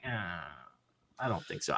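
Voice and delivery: low, very soft doubtful aside; skeptical. Retold or imitated. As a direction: voice your private doubt, low and quiet. Retells doubt about promised deadline.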